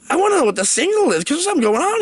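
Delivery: high, rushed, worked-up protest; mock insistence. Hero demands the signal from his boss, mock insistent. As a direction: act out stubborn pleading with the boss.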